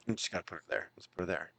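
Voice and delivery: very low, hushed mumble; muttered aside. Retold or imitated. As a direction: mutter it far under your breath. Retelling Heenan's grudging handshake offer.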